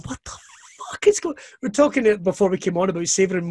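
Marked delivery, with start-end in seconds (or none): 0.0-1.3 slow, high, breathy whisper of disbelief; hushed high stunned whisper; awed disbelief. Retold or imitated. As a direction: whisper it slowly, high, as if you can't believe your eyes. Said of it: "I just remember going".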